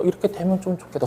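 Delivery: soft, quick murmured hope; timid wish. Retold or imitated. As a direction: voice a shy little wish under the breath. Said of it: "소심하게라도".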